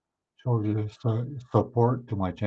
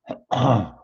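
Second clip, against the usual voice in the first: throat clearing; 4 dB louder than usual. Real, not acted. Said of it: "went through the wrong hole".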